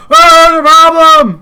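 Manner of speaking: high loud shout; alarmed yell. Acted. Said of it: "packaging guys are like".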